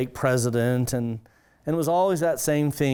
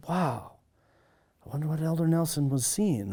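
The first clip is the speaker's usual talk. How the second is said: mock shocked wonder; 4 semitones higher, 3 dB quieter than usual. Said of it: "he's acting really shocked".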